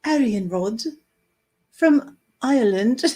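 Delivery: playful put-on British accent; mock British announcing of a name. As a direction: say it in jokey British tones. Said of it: "that was with a British accent".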